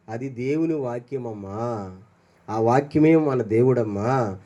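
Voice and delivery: a parent's slow, low, tender reply; tender answer to child. Retold or imitated. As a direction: gently tell your child.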